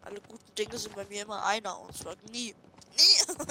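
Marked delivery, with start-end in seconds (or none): 3.0-3.5 high, loud, punched; emphatic never. Real, not acted. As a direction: hit the word hard. Joking about never succeeding.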